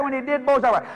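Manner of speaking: ordinary talk, nothing marked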